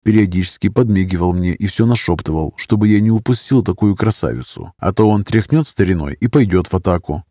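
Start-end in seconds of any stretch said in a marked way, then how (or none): none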